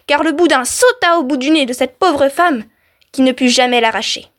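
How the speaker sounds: ordinary delivery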